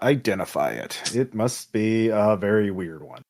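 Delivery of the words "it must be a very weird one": ordinary talk, nothing marked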